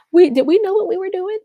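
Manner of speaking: high, put-on voice asking; mock their past selves with a high squeaky question; high teasing self-mockery; mock naive question. Mocking their own naivety.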